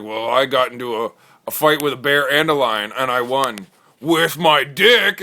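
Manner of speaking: a guy boasting about his fight, loud and slow; bragging bro. Acted. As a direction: lay it on like a tough guy. Bro brags at table.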